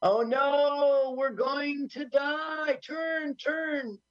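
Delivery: high hammed-up panic, slow on "we're going to die"; mock panic. Friend voicing the doomed bad guys, hammed-up high panic. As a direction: squeal like a cartoon villain about to explode.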